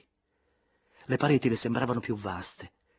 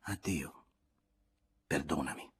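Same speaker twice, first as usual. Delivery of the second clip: soft, sweet farewell; gentle goodbye; 6 semitones lower, 7 dB quieter than usual. A narrator's reading. Elena saying goodbye to Andrea, softly. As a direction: let it fall gently. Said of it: "Elena rispose dolcemente".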